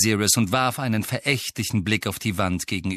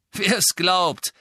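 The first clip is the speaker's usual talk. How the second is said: higher, raised sceptical interjection; disbelieving call; 11 semitones higher, 4 dB louder than usual. A narrator's reading. Master interrupting from behind, sceptical. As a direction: cut in loudly with disbelief. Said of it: "ertönte eine Stimme hinter Harry".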